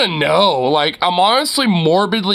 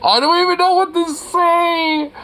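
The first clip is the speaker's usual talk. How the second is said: high shrieking disbelief; shrieking jackpot winner; 12 semitones higher than usual. Real, not acted. Overwhelmed by trailer.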